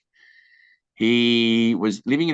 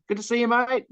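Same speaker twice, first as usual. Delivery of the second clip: much higher, matey call; imitated hearty greeting. Tent dweller pleased to see another Aussie, high hearty call. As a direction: hail a fellow countryman in a raised voice.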